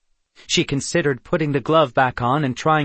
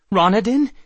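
high, loud shocked question; startled recognition; 8 semitones higher, 7 dB louder than usual. A narrator's reading. Grabbed from behind, she knows that voice, startled recognition. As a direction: blurt the name in alarm.

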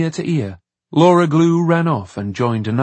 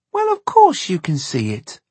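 put-on indignant little girl, high-pitched; 6 semitones higher, 3 dB louder than usual. A narrator's reading. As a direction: protest in a high child's voice. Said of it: "Laura Glue said indignantly".

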